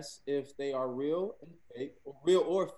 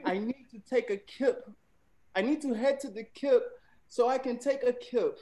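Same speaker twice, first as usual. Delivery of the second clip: British-accented example sentence, pitched up; attempted British accent; 7 semitones higher than usual. Shown as a demonstration. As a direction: have a go at a British accent, higher than usual. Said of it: "in a British accent".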